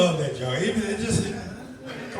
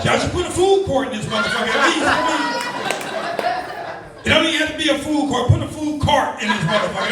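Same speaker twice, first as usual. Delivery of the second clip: laughing loud rant; 4 semitones higher, 4 dB louder than usual. Real, not acted. Mock complaint to crowd.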